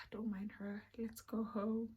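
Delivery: unvoiced hushed aside; whisper. Whispering to Richard about her friend. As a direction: whisper it behind her back.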